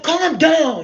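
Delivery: loud, high bark to calm her; shouted order. Retold or imitated. Guide in another dimension shouting at her, loud. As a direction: yell it to make her settle. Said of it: "he was like".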